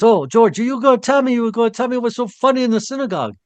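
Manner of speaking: high-pitched imitated Bostonian friend; Boston accent. Friend demands the joke over coffee after service, Boston accent. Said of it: "He had that Boston accent".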